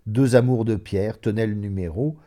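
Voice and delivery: usual speaking voice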